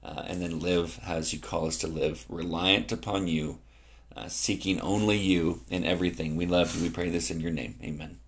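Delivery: low, soft closing prayer. Real, not acted. Pastor closing a prayer to God, low soft prayer.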